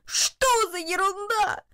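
high, drawn-out wail of disbelief; boy's outraged cry; indignant exclamation. A narrator's reading. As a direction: exclaim it slowly, voice pitched right up. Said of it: "воскликнул один".

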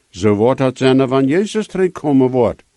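ordinary delivery

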